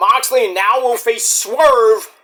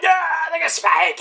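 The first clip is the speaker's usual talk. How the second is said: higher, gleeful; mock excited; 10 semitones higher than usual. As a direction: show off the bat proudly.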